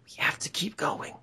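hushed, breath-heavy insistence; mock obsessed scientist. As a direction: drop to an intense near-whisper. Acted scientist insisting on continuing.

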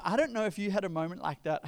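ordinary delivery

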